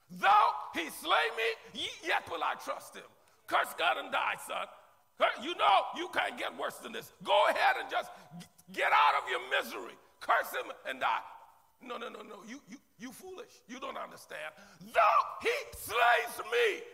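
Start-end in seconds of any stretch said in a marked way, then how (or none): 0.1-3.0 ringing proclamation. Shown as a demonstration. 3.5-11.2 mock taunting wife. Job's wife taunting voiced. 14.8-16.8 soaring shout. Shown as a demonstration.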